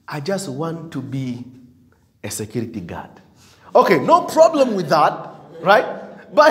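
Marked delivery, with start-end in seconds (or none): 0.1-3.1 low, soft, small-voiced mumble; mock timid mumble. Preacher mimicking a timid churchgoer's small dream, softly. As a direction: play the unambitious one, sheepish and small.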